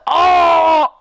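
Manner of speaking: high, loud drawn-out "oh"; big mock-shocked outburst; loud exclaimed oh. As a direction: cry out a long, teasing oh. Reaction to jealous friend's line.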